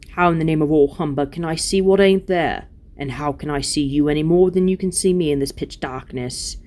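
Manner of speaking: grumbling, exasperated dwarf; surly dwarf Diggle, scornful. Acted. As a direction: scoff back as a stubborn dwarf.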